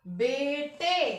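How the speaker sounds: बेटे very slow, no nasal at the end; slow plain plural. Shown as a demonstration. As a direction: stretch बेटे to show no bindi. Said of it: "पीछे बिंदी सुनाई दे रही है?". (बेटे धीरे, बिना अनुनासिक)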